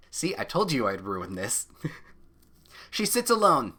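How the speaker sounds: usual speaking voice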